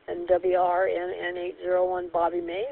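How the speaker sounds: ordinary delivery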